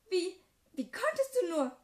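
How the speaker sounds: high, loud anguished shout; shrieking in disbelief; shouted accusation. Acted. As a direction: scream it at your betraying best friend. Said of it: "schrie sie mich an".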